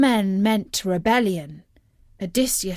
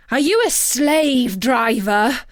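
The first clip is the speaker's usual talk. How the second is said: louder, indignant challenge; angry outcry; 3 semitones higher, 5 dB louder than usual. A narrator's reading. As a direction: throw the accusation at the captain. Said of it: "cried out Lord Eurylochus".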